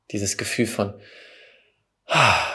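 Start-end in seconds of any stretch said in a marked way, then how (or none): 2.1-2.5 re-enacted sigh of relief; relieved sigh. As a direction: let the tension go in one long "ah". Acts out the relief felt. (erleichtertes Aufseufzen)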